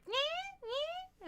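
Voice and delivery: high little nyeh meows like a cat; imitated cat meow. Owner imitating cat talking when she comes home, high meows. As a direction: mew like a chatty cat greeting you. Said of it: "And he's like".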